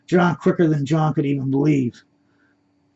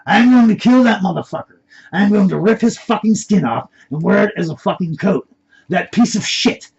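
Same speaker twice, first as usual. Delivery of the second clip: much louder, higher enraged yell; furious shout; 6 semitones higher, 8 dB louder than usual. A narrator's reading. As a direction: scream it with murderous rage.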